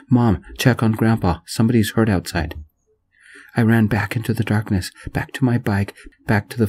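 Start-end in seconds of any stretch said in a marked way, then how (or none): none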